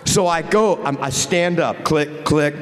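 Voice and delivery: ordinary talk, nothing marked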